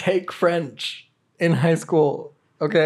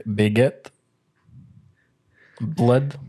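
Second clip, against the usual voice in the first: put-on French words in a would-be French accent that slips into Russian; botched French accent, Russian-sounding; much slower than usual. Picturing France for a pigeon movie, botched French accent. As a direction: attempt French, land closer to Russian. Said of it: "That's not a French accent. That's, like, Russian".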